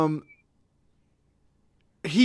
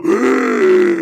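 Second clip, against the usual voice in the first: loud startled gasp on waking; sharp gasp; 8 semitones higher, 9 dB louder than usual. Acted. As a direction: gasp awake suddenly. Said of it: "you hear him go".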